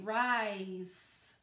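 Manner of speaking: "rise" drawn out slowly, sounding the s; teacher stretching "rise" to hear the letters; stretched out. Shown as a demonstration. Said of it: "if I really stretched it out".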